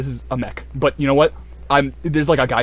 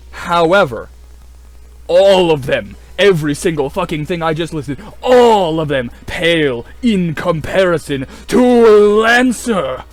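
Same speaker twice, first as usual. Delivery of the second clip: big, loud, slow buildup; building hype; 3 semitones higher, 7 dB louder, slower than usual. Real, not acted. Hyping Lancer to viewers, loud dramatic buildup. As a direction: swell it up like announcing a champion. Hyping up his favourite game.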